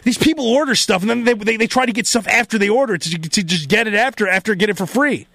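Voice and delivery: ordinary talk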